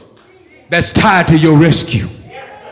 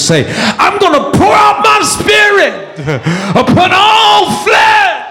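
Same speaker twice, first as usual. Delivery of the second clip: raised preacher's cadence climbing to a cry; full-force preaching; 5 semitones higher than usual. Acted. Preacher proclaiming Zechariah's vision, full-force preaching. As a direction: build to a high, stretched proclamation.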